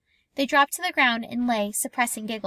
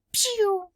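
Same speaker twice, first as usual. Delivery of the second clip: high pew firing noise; child fires an imaginary rifle; mock gun noise; 9 semitones higher than usual.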